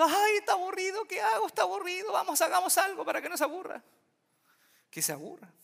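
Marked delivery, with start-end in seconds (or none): 0.0-3.8 fussing parent. Retold or imitated. Preacher mocking parents who rush to entertain a bored child, hammed-up high fretting voice. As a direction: ham up a frantic mother fretting.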